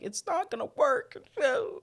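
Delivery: high-pitched mock protest, far from her own voice; high squeaky Ann voice. Ann fleeing the bathroom, high mock protest. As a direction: squeal it like a panicked woman. Ann's quote, much higher.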